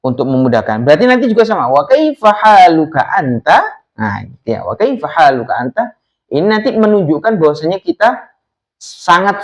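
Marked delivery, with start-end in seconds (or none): 1.7-3.8 "Wa kaifa haluka anta" lifted on "anta"; questioning rise. Shown as a demonstration. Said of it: "maka nadanya nanti dinaikkan ya". 4.6-6.0 "Wa kaifa haluka anta" lifted on "anta"; questioning rise. Shown as a demonstration. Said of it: "maka nadanya nanti dinaikkan ya".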